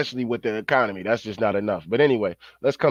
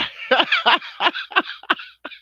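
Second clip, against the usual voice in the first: high laugh. Real, not acted. Vlogger cracking up at his own mock onlookers, high cackle. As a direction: cackle pitched way up.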